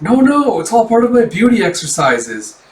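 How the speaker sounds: high, loud, hasty-sounding mock protest; lightly hammed-up flustered excuse; flustered denial. Flustered cover-up in manga retelling.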